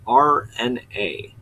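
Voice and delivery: R N A said slowly, letter by letter; spelled-out pronunciation. Shown as a demonstration. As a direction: spell out R N A with each letter separate. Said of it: "pronounce it the way".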